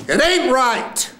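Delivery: very high, slow cry; full-out acted line. Actor ending the first scene, high drawn-out cry. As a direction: throw it up high and hold it.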